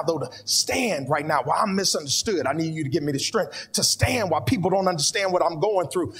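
fervent prayer. Acted. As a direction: cry out to God with growing force. Preacher breaks into fervent prayer.

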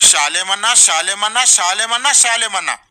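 rising singsong list, climbing in pitch; mocking repetition. Critic counting endless thumbnails, mocking repetition. Mocking the name plastered everywhere.

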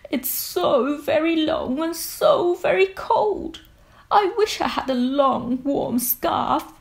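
a shivering giraffe begging for a scarf; sad cold giraffe. Acted. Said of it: "said the giraffe".

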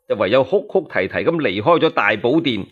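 usual speaking voice

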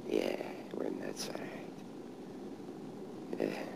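soft, slow wry mumble; quiet self-mocking murmur; whisper. Real, not acted. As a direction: drop to a hushed aside. Wry aside at grave.

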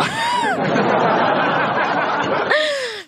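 soft high giggle; fading high laugh; high breathy laugh. Real, not acted. Laughing after teasing.

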